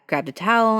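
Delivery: ordinary delivery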